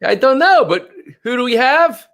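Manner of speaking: high, loud hype voice; excited announcer. Acted. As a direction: pump up the crowd. Hyped host introduction.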